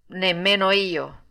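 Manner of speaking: slow, a touch of sorrow; mild sadness. Acted. Poor old father admitting he has no money, mildly sad. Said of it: "facendosi triste".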